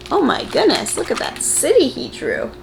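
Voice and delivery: usual speaking voice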